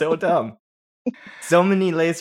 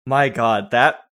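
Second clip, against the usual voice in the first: ordinary talk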